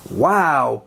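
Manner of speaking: usual speaking voice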